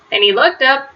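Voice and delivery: ordinary delivery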